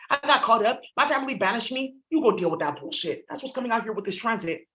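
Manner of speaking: ordinary talk, nothing marked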